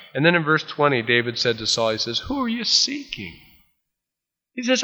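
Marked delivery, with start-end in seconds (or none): 2.2-3.4 playfully imitated distant call; high-pitched call. David calls across to Saul.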